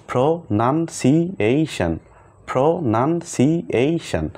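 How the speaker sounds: প্রনানসিয়েশন drawn out slowly, syllables spaced; slow syllable demo. Shown as a demonstration. Teacher repeating প্রনানসিয়েশন slowly for the students. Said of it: "এটা যদি আমি পুরোটাই পড়ি".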